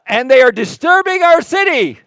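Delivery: angry accusation. Retold or imitated. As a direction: shout it to stir the rulers. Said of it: "here's what they said".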